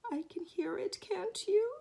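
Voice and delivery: high, nervous little question; anxious Piglet. Acted. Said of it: "said Piglet anxiously".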